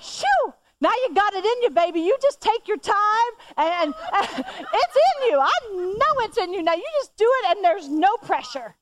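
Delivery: butter her up now that the pressure is off; sweet encouragement. Retold or imitated. Mother suddenly sweet, encouraging.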